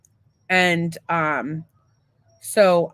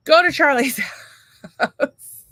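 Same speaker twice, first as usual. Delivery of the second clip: laughing; 7 semitones higher, 6 dB louder, slower than usual. Real, not acted. Laughing mid-sentence.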